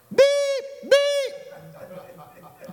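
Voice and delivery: loud, very high bleeps; imitated censor beep. Preacher censoring Peter's cursing, high mock bleeps. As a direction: bleep out curses in a piercingly high tone.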